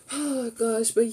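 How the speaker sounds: usual speaking voice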